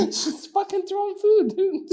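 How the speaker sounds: can barely get it out for laughing. Real, not acted. Laughing while retelling.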